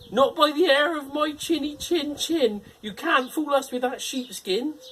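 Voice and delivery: very high, loud defiant pig squeak; squeaky little pig. Acted. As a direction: refuse boldly in a tiny voice. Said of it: "said the second little pig".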